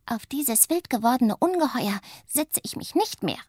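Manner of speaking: high, huffy refusal; sulky little witch. Acted. Little witch refuses the sledge after the crash, huffy. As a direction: put your foot down.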